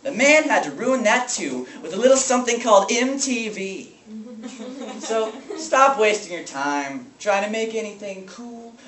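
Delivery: high, heated venting; bitter rant. Acted. Teacher venting to children about the man ruining rock, bitter rant. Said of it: "lashing out his rage".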